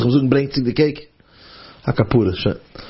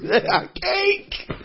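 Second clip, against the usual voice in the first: delighted; 3 dB quieter than usual. Acted. Angel delights in the cake.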